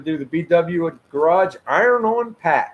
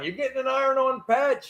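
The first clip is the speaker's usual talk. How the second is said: higher, delighted winner announcement; excited announcement; 10 semitones higher than usual. Real, not acted. Host tells the winning viewer his prize, pitched high and excited. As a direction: tell him he's won, beaming up high.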